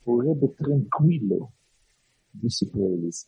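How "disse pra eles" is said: ordinary delivery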